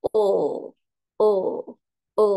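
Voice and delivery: O dipping in pitch, drawn out; third tone. Shown as a demonstration. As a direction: draw each o through a dip. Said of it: "Kalau nada tiga". (o dengan nada tiga yang melengkung)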